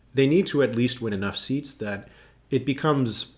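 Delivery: ordinary delivery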